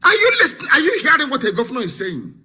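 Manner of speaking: normal talking voice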